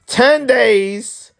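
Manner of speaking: TEN DAYS high and drawn out; emphatic repeat. Real, not acted. Griping to viewers about comments, emphatic repeat.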